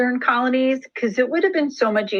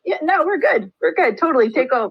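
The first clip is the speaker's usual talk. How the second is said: higher-pitched mock eagerness; acted breezy surrender; eager agreeable colonists; 6 semitones higher than usual. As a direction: give in readily, voice pitched up. Dutch readily surrender in acted dialogue.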